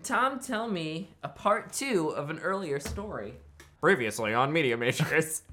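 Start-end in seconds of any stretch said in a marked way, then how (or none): 3.8-5.4 loud TV-recap intro voice; mock recap announcer. Host introduces part two of a story, mock announcer. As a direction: announce it big, like a series recap.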